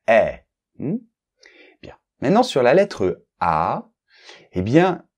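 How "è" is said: est said as an open è; est pronounced like the è of chèvre. Shown as a demonstration. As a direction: open est wide, set apart by a pause. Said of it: "il y a une différence quand même entre".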